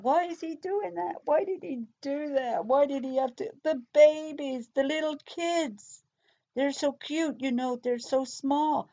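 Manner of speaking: high imitated crying voice, slowing on the babies; imitating crying. Suspect breaking down to the detective, high imitated crying. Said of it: "she's crying and she's breaking down".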